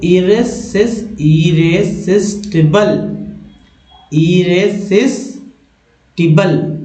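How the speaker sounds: Irresistible said slowly, syllable by syllable; slow model reading. Shown as a demonstration. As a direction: stretch irresistible out for learners. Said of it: "ऐसे पढ़ेंगे".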